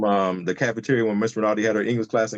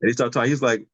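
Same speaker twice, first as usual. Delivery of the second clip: ordinary delivery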